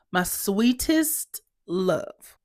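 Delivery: very slow, drawn-out endearment; exasperated endearment. Acted. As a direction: stretch each word with strained patience. Repeats appeal, drawn out.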